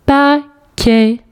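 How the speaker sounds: "Paquet" split into pa, quet with a gap; syllable by syllable. Shown as a demonstration. Teacher correcting the syllable exercise, syllable by syllable. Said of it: "les syllabes où tu entends".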